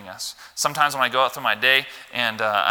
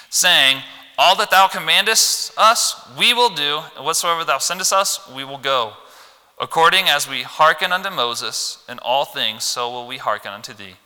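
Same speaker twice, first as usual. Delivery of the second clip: put-on loud, high, slow reading of old-English verse; solemn loud Bible recitation; declaimed scripture; 3 semitones higher, 6 dB louder than usual. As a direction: proclaim the verse slowly to the church.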